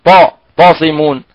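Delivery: ordinary talk, nothing marked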